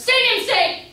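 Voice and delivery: loud, high call to stop her leaving; calling after her. Acted. Humphrey calling after Luce as she leaves, loud and high. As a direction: cry out to hold her back.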